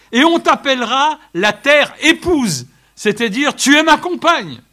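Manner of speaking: loud, high proclamation of scripture; preaching at full force. Acted. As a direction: proclaim it to the whole room. (proclamation prêchée à pleine voix)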